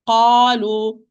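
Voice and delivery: Qalu voiced and heavy, no breath; firm voiced Qalu; correct qalu. Shown as a demonstration. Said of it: "There is no flow of breath".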